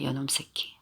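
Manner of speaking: hissed under the breath, barely voiced; whispered curse. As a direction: spit the insult out in a whisper. Boy vows revenge after teasing.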